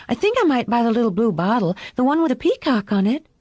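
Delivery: put-on high, quick child's chatter; innocent little girl. A narrator's reading. Daughter explaining what she might buy, innocent and quick.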